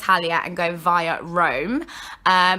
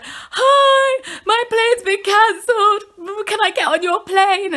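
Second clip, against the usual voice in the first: high, loud mock-crying wail; tearful plea; 12 semitones higher, 4 dB louder, slower than usual. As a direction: beg the desk clerk through exaggerated tears, drawn out. Tearful plea at airline desk.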